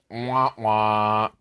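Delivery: mock sad trombone wah wah. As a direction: droop it like a failing horn.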